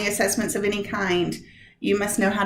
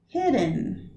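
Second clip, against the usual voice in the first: "Hidden" soft, HID stressed, falling; 2 semitones higher, 4 dB quieter than usual. Shown as a demonstration. Teacher giving the answer for "Hidden" softly. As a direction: lean on HID of "Hidden", then drop. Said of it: "The answer".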